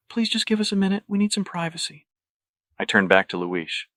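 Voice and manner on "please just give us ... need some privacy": high, polite smiling request; professional smile. A narrator's reading. Patrick asks the guard for privacy, smiling politeness. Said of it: "Smiling professionally, I tell him".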